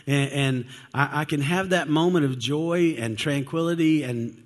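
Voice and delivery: usual speaking voice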